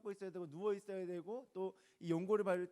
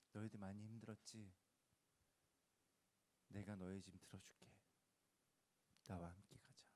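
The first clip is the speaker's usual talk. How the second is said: low, very soft, with long pauses; soothing; 7 semitones lower, 11 dB quieter than usual. Retold or imitated. Preacher voicing Jesus inviting us to walk together, soothing and hushed. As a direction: offer help gently, almost under the breath. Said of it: "예수님은 달래주신 겁니다".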